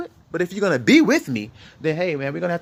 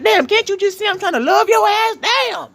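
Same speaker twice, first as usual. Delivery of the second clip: husband venting at his unruly woman, shouting in frustration; exasperated yell; 10 semitones higher than usual. Retold or imitated. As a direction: blow up like a fed-up man at his woman. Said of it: "'Cause you're like".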